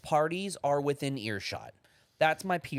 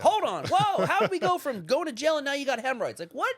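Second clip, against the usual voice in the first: higher and louder, thrown by the overshare; startled listener; 11 semitones higher, 5 dB louder than usual. Retold or imitated. Bystander overhearing an overshare in public, loud higher mock surprise. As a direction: react like someone caught off guard by a stranger's confession. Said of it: "And you're like".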